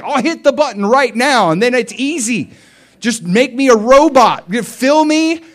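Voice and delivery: high, loud, eager begging; pleading cry. Retold or imitated. Preacher begging God to take control, high and loud. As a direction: plead to just be fixed now.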